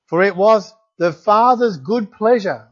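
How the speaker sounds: slow, weighty reading of scripture. Acted. Preacher reads Romans to the congregation, slow and weighty. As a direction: read it out like holy words to the congregation. Reads Colossians, pauses to relish.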